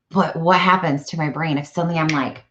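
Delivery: ordinary talk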